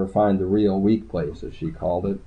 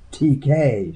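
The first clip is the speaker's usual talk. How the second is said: mildly gasped recognition; understated shocked gasp; 5 semitones higher than usual. A narrator's reading. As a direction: say it with a small, higher-pitched gasp. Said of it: "she gasped".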